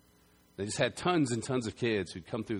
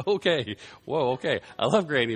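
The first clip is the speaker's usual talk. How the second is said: mock surprise; 4 semitones higher, 8 dB louder than usual. Retold own surprise at hug.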